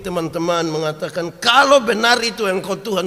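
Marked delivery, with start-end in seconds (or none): 1.4-3.1 raised high and loud across the water; calling out. Retold or imitated. Peter calling out to Jesus walking on the water, loud and high. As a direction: shout it to someone far off on the sea.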